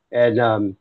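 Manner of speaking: ordinary talk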